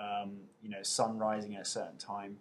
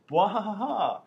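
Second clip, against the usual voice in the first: hammed-up evil laugh, higher and louder; mock villain cackle; 10 semitones higher, 9 dB louder than usual. Repeating the username as a hammed-up evil cackle. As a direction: throw back your head and cackle. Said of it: "do you like how I say the usernames".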